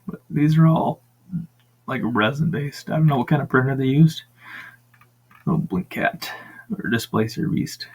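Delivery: ordinary talk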